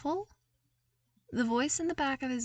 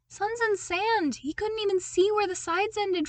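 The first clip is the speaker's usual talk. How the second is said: much higher and louder, gasping in awe at the sheer size; awed exclamation; 12 semitones higher, 5 dB louder than usual. A narrator's reading. Luke staring up at the enormous palace, awestruck and high. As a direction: blurt it out like a farm boy stunned by a giant building.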